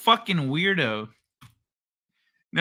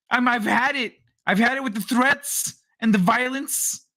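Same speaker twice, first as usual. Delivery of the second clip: tough-guy commenter impression; 7 semitones higher than usual. Streamer mocks the chat guy threatening over tennis balls, high hammed-up shouting. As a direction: ham up the keyboard tough guy. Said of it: "let me be this guy".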